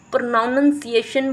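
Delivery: प्रोनाउंसिएशन with an extra syllable, pro-noun-un-si-ation; mispronounced word. Shown as a demonstration. Showing a teacher who is a bad model, mispronounced. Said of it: "आपका ही प्रोनाउंसिएशन सही नहीं है".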